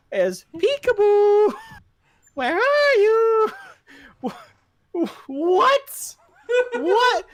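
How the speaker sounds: very high, slow, laughing sing-song; mock a goofy kid calling peekaboo. Mocking the kid calling for the Jedi, high laughing sing-song. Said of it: "immediately turns around and goes".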